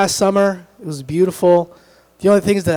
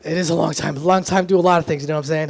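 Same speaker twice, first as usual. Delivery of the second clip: usual speaking voice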